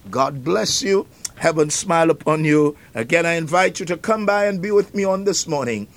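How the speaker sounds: ordinary talk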